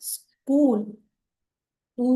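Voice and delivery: "ಸ್ಕೂಲ್" stretched, then "ಊ" alone; vowel sound isolated. Shown as a demonstration. Teacher showing which vowel is heard, "ಊ" held on its own. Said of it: "ಅಂತ ಬರುತ್ತೆ ಇಲ್ಲಿ".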